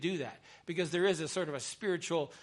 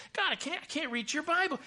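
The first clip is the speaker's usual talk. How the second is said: much higher pleading voice; woman praying, imitated; 12 semitones higher than usual. Chaplain acts out parishioner talking to God, imitated pleading. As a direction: mimic her helpless plea to God. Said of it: "she's talking to God".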